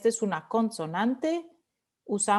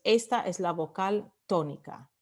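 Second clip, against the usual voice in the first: ordinary talk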